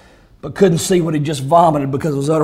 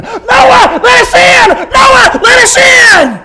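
high, urgent full-force scream; 12 dB louder, much slower than usual. Acted. Drowning world begging Noah at the ark, urgent screaming. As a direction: scream to be let in.

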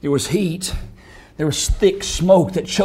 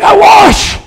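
high, anguished scream in broken phrases; Pontius Pilate screaming; 12 dB louder, slower than usual. Acted. Condemned governor washing his hands in hell, anguished screaming. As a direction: scream a desperate disclaimer. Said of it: "still screaming in hell".

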